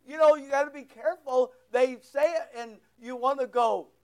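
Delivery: usual speaking voice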